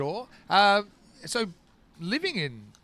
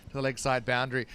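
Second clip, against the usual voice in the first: brisk, lifted cricket call over the action; live play-by-play; 5 semitones higher, 4 dB louder than usual. Acted. Commentator breaking off the chat to call the shot, lively play-by-play. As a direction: call the shot as it happens, bright and quick.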